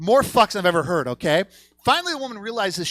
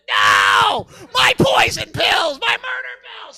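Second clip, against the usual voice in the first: high, drawn-out hammed-up scream; anguished wail; 8 dB louder, much slower than usual. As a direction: howl in mock despair over something lost. Wailing as pills come back up.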